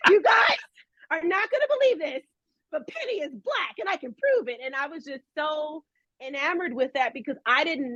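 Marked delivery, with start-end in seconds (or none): 0.1-4.6 much higher eager telling; bursting to tell everyone; excited announcement. Retold or imitated. Kid announcing her discovery to friends, excited. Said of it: "And I was like".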